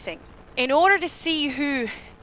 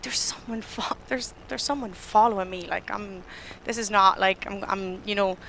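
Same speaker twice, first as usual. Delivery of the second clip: nervous inner voice; 4 semitones lower, 6 dB quieter than usual. Retold or imitated. Nervous inner thoughts walking home.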